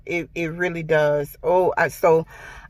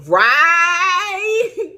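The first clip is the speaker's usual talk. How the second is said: higher, laughing relief; 11 semitones higher, 5 dB louder than usual. Real, not acted. Laughs after recounting ordeal.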